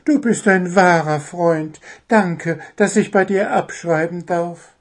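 high, gushing little bird; happy, high-pitched bird; overjoyed gratitude. A narrator's reading. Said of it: "freute sich der kleine Vogel".

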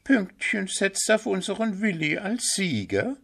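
very high, stunned squirrel question; can't believe what she hears; astonished squirrel. A narrator's reading. Squirrel Hüpf hears about the bet, astonished high question. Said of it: "fragte Eichhörnchen Hüpf verblüfft".

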